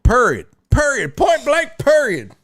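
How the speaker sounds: sassy, punchy mock of a catchphrase; hammed-up sassy period. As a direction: spit each word out like the people you hate. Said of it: "I hate people that say that shit".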